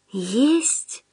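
puzzled question. A narrator's reading. Худяков misunderstands Остап's order, soft puzzled question. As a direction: ask softly, not understanding. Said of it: "не понял Худяков".

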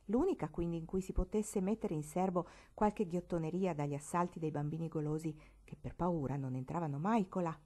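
usual speaking voice

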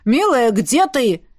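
slow, drawn-out calling; man calling out. A narrator's reading. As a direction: call for your darling as the baron. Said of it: "звал мужчина".

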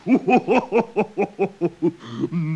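high rising laugh, bubbling over; burst of laughter. Acted. Creature laughing at a friend's clumsy somersault, high rising laughter.

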